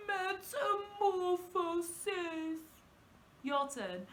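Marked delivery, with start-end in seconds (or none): 0.1-2.6 slow, crying, drawn-out word; really sad. Shown as a demonstration. Teacher saying the word sadly for children. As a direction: whimper it like you're heartbroken. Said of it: "like we're really sad".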